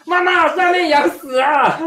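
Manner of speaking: put-on high, loud child calling mom; shrill child shouting for mom; child's cry. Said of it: "然后他们在说".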